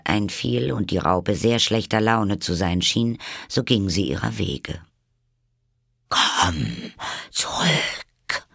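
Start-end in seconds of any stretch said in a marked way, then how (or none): 6.1-8.5 calling caterpillar. A narrator's reading. The caterpillar calls Alice back, whispered. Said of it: "rief ihr die Raupe nach".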